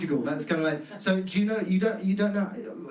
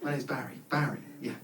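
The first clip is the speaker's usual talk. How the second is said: weary repeat; 5 semitones lower, 7 dB quieter than usual. Retold or imitated. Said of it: "It's like".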